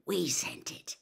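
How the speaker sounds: conspiratorial whisper. A narrator's reading. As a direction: confess the prank under your breath. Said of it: "Fred whispered to Harry".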